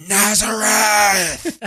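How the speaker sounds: high, loud, drawn-out mock death cry; imitated dying cry. Villain calls out Jesus before dying, imitated cry. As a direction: stretch it out as a hammed-up final shout. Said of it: "he goes".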